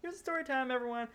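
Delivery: high sing-out announcing story time; mock announcement. Real, not acted. Friend announces story time to cohosts, sing-out announcement. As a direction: pitch it up like calling a group to gather.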